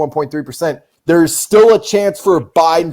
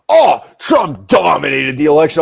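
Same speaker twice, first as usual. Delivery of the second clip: mock boasting; 3 dB louder than usual. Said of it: "when people are like".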